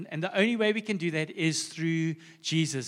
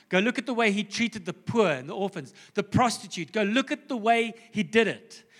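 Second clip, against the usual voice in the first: preaching build; 3 semitones higher than usual. Acted. Repeated "go look" exhortation.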